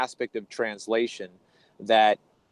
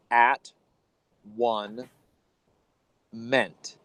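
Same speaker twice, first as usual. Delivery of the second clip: "at, one, meant" as three separate words with long pauses; spaced-out teaching breakdown of "at, one, meant"; broken into parts; much slower than usual. Shown as a demonstration. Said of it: "it is broken down into three words".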